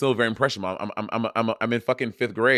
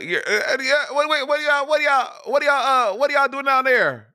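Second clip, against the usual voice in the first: high, loud mock family holler; imitating Bob with family; 13 semitones higher, 3 dB louder than usual. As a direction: holler it as an exaggerated impression of a co-host. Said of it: "when Bob is around his family".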